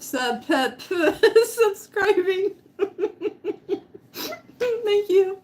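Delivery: high giggly thanks; laughing. Real, not acted. Thanking a new subscriber, laughing high. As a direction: stumble over it while laughing.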